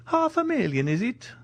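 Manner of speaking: very high-pitched, amazed question; astonished. Acted. Major learns he has a fortune, astonished. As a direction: leap up high in disbelief.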